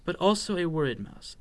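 usual speaking voice

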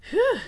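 high, loud "whew"; sigh of relief. A narrator's reading. Mouse relieved it was no cat, sighing. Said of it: "sighed Squeak".

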